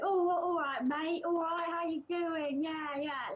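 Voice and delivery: high, chirpy Cockney street greeting; Cockney accent. Retold or imitated. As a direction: greet a mate in broad Cockney. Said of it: "kind of like the Cockney accent".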